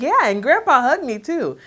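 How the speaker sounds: put-on high, sweet kid voice; toddler voice. Mother imitating her son about grandpa's hug, high child voice. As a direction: proudly add it like a little boy. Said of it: "He's like".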